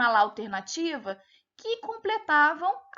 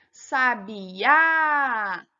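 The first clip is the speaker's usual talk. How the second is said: "Sabiá" with the last Á loud and long drawn out; stress on final Á; 7 dB louder than usual. Shown as a demonstration. Teacher showing pupils an oxítona, stress on the Á in "Sabiá". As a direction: stretch and punch the final Á in "Sabiá". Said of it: "O A era a sílaba tônica".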